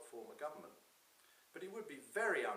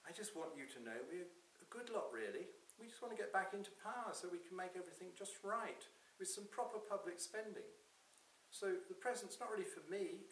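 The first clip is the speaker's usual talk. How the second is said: higher-pitched earnest plea to Santa; lightly voiced supplicant, pitched up and hopeful; pleading politician; 8 semitones higher than usual. Voices Ed in story.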